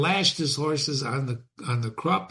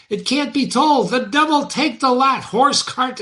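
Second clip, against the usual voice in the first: high, raised cart driver yelling at his horse; high-pitched furious carter's shout; mad shouting driver; 11 semitones higher, 5 dB louder than usual. Acted. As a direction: bellow at the stuck horse like a man gone mad. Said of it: "lashed and shouted as if mad".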